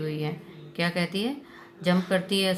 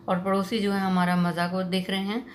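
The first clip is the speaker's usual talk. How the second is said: ordinary delivery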